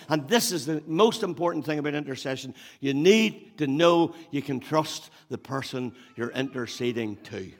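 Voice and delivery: normal talking voice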